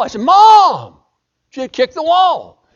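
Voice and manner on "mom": high, loud pleading protest; protesting cry. Retold or imitated. Boy protests mother's order to kick the wall, loud pleading. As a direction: beg her to drop it.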